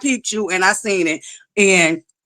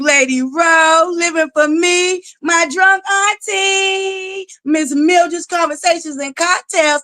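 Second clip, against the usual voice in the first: high, long-held name calls; singsong shout-out; 9 semitones higher, slower than usual. Real, not acted. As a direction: call out each viewer, stretching the words up high. Calling out viewers by name.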